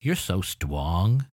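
put-on slow, drawn-out baby talk; mock baby talk. Shown as a demonstration. Teacher mimicking a parent's baby talk, slow. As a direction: coo it slowly like cooing at a baby. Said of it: "change the sound structure of words".

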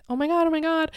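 high, excited little exclamation; re-enacted giddy shock; retold amazement. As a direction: squeal it lightly in disbelief. Retelling her first amazed reaction.

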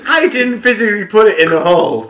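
mock indignation. Friend mocks the complaint.

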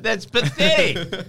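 scornful. Real, not acted. Scoffing at the discount code.

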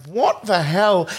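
high, slow drawn-out disbelief; mock bewilderment. Viewer reacts to absurd film scene, mock bewilderment. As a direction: stretch it out in baffled outrage.

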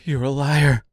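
restrained scream of accusation; mildly screamed, angry outburst. A narrator's reading. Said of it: "screamed the seamstress".

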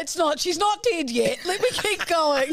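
put-on child begging mum to continue; defiant kid. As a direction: plead to keep performing, laughing. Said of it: "No, Mum".

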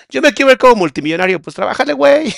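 loud, high-pitched hammed-up outburst; mock exclaiming. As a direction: act out a loud, playful argument. Acting out a hypothetical exchange. (exclamación burlona, en voz alta y aguda)

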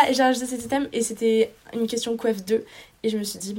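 ordinary talk, nothing marked

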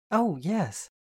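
bright, eager yes; cheery reply. A narrator's reading. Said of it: "came her cheery reply".

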